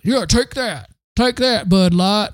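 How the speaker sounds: put-on Southern drawl for a fool; dim-witted Southern accent. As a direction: play a dopey Southerner. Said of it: "I'm defaulting to a Southern accent to indicate someone who is stupid".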